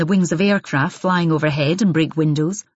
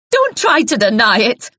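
louder, higher, strident; rising shrill accusation; 6 semitones higher, 6 dB louder than usual. Acted. Jealous wife cuts Isabel off, shrill and loud. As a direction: push it up into a shrill demand. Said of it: "voice rose now, became shrill".

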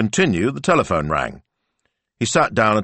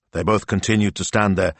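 usual speaking voice

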